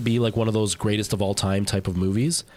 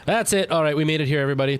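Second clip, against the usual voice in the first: excited; 6 semitones higher, much faster than usual. Real, not acted. Reaching the goal, calling out.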